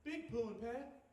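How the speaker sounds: lightly voiced toddler with babyish words; imitated two-year-old. Two-year-old jumps in to ask uncle for a spoon, mock toddler voice. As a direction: put on a little kid asking eagerly. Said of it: "the two-year-old jumps in".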